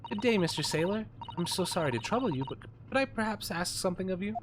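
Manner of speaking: butter him up, too fast; polite young lady. Acted.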